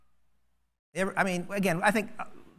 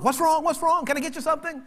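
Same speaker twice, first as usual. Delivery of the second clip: alarmed helper; 10 semitones higher, faster than usual. Retold or imitated. Worried congregant rushing over.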